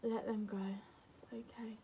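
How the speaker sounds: quiet, gentle "it's okay"; soft reassurance. Shown as a demonstration. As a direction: soothe like to a frightened friend. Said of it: "soothing advice".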